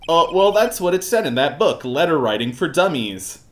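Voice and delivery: Larry. Acted. Game character excuses his letter writing, high and defensive. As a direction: make a whiny excuse. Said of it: "doing the Larry voice".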